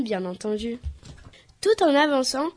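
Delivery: ordinary delivery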